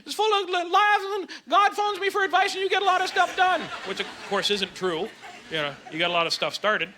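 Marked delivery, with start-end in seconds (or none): 0.0-3.6 high-pitched manic gushing; mock manic. Comic acting out the manic side of bipolar, hammed-up manic excitement. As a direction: gush as if wildly elated. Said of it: "Manic is like".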